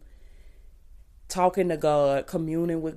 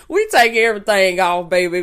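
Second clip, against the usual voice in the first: loud playful; 2 semitones higher, 9 dB louder than usual. Real, not acted.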